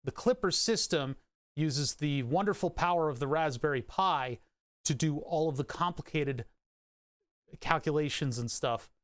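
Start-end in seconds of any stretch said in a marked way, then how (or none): none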